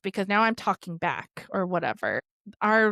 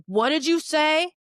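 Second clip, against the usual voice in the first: menacing demand; 8 semitones higher, 5 dB louder than usual. Retold or imitated. Stepdad threatening before hitting.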